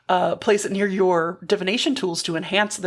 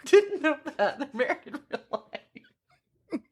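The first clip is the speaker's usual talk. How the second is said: 1 laughing; 5 semitones higher, 4 dB quieter than usual. Real, not acted. Laughing at trivia.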